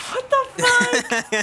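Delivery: slow, high what-the-fuck; drawn-out baffled outcry; stunned disbelief. Real, not acted. Player reacting to the running child, stunned. Player shocked by opposed check.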